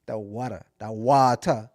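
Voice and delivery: "water" as "wah-ta", no final r; island-style "water". Shown as a demonstration. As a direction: open up "water" into "wata". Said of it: "certain things I have to say".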